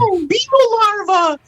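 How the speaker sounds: very high, squealing woo. Pretend. Pop star hyping beetle larva to kids, high squeal. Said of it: "we need [name] to come out and be like".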